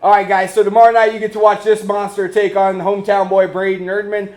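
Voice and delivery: loud, upbeat promo sign-off; announcer voice. Acted. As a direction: hype the fight to the viewers. Host sign-off to camera.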